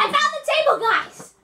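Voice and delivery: high, loud excited call; proud shout of discovery. Real, not acted. Triumphant call after searching.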